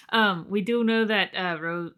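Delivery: normal talking voice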